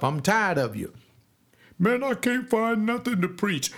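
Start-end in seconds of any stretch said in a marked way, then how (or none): 1.8-3.7 high whiny mock preacher; high mock whine. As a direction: sneer at a lazy preacher. Mocking lazy preachers.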